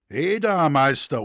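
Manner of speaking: high, loud call to cut in; interrupting call. A narrator's reading. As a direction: call out to stop the speaker. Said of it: "unterbrach ihn der Tuchscherer". (laut dazwischengerufen)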